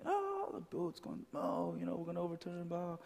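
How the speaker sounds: playful mock terror in the storm; mock panic. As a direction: squeal it like a frightened disciple, half-joking.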